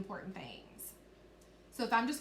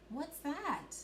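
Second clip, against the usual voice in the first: higher-pitched character question; question intonation; 5 semitones higher, 3 dB quieter than usual. Shown as a demonstration. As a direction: lift the voice in wondering surprise. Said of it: "when I asked a question, I made my voice go up".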